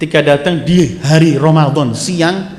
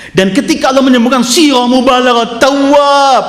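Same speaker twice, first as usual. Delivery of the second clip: higher, louder preaching, pressing each phrase home; preaching at full force; 10 semitones higher, 3 dB louder than usual. Acted. Preacher exhorting the congregation on repentance, fervent and raised. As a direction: drive the warning about sin hard into the room.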